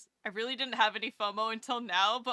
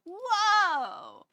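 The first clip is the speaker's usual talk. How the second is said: much higher and louder, a delighted gasp of surprise; big surprised whoa; 10 semitones higher, 8 dB louder than usual. Real, not acted. Streamer amazed at the viewer count, surprised whoa. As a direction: let it burst out, amazed.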